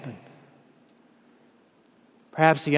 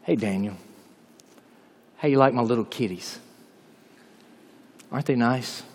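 much lower and much softer, playful; gentle angel voice; 8 semitones lower, 8 dB quieter than usual. Acted. Angel reassuring Daniel about the lions, soft playful murmur. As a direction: murmur it like a kindly angel teasing. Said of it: "the angel might have said to him".

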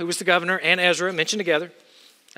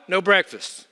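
normal talking voice

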